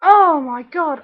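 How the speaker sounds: ordinary talk, nothing marked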